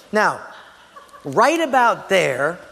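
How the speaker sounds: ordinary talk, nothing marked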